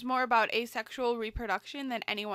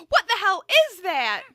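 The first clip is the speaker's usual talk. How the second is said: startled gasp; 6 semitones higher, 10 dB louder than usual. Acted. Mother startled by the monkey, gasping loud alarm. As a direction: gasp then loud high alarmed question.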